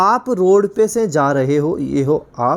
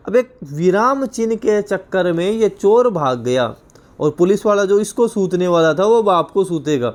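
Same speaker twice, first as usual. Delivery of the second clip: normal talking voice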